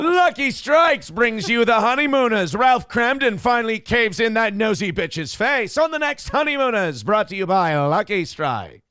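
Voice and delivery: put-on loud, upbeat old-time ad read; chipper 1950s ad. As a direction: sell it like a fifties sponsor spot. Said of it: "your ad read for the honeymooners".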